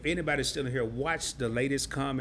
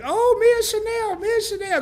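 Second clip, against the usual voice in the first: mock starstruck fan; 7 dB louder than usual. Fans rolling up to the YouTuber, high mock gushing. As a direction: imitate a fan bragging about meeting her. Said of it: "when people roll up to you like".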